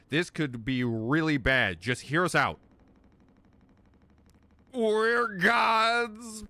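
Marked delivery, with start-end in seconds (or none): 4.7-6.4 slow American Southern drawl; southern accent. Acted. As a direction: lay on a Southern accent, slowly. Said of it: "he's turned into a southern".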